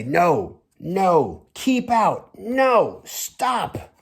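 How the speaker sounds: high, sharp voice shoving people away; refusing countries. Retold or imitated. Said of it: "They say".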